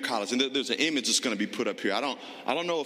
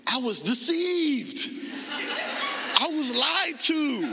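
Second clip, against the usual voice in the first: much higher and slow, a hammed-up betrayal; mock outrage; 12 semitones higher, slower than usual. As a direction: play at being wronged, laughing, drawn out. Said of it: "thinking, like".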